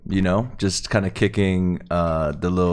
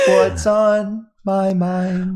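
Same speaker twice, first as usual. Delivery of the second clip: high, slow mocking echo; mocking imitation; 12 semitones higher, much slower than usual. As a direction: drag it out, teasing. Mocking echo of friend's question.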